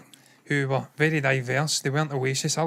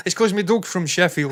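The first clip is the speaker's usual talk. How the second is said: put-on higher, playful Yorkshire accent; mock Yorkshire accent; 4 semitones higher, 4 dB louder than usual. Owner explaining his dog from Sheffield, playful Yorkshire accent. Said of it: "I just love speaking in Yorkshire accent".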